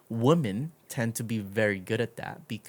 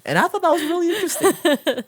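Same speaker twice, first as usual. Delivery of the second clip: amused; 11 dB louder than usual. Real, not acted. Amused reaction after laughter.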